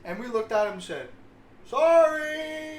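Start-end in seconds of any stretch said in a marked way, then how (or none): 1.7-2.8 loud high mock apology; shouted sorry. Teens shouting back to angry bystander, loud high sorry. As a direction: yell it back cheekily.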